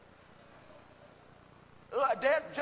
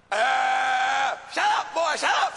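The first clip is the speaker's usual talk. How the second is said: mock mother yelling shut up; 3 semitones higher, 7 dB louder, much slower than usual. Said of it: "she said".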